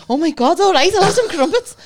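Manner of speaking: hammed-up husband caving; husband fed up with crumpet nagging; exasperated giving in.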